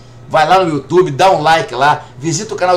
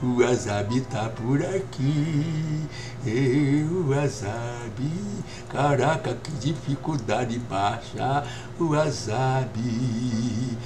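muttering to himself; 6 semitones lower, 11 dB quieter, much slower than usual. Real, not acted. Searching the game map to himself.

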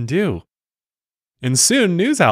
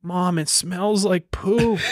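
put-on higher, drawn-out kid voice; child's whine; 8 semitones higher, 3 dB quieter, much slower than usual. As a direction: complain slowly to mom like an upset child. Voicing an upset child.

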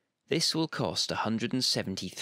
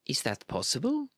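surprised; 4 semitones higher than usual. A narrator's reading. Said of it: "Emira looked surprised".

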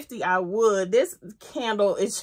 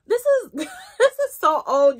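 loud, high, slow laughing disbelief; 7 semitones higher, 7 dB louder, slower than usual. Real, not acted. Laughing at old bottle.

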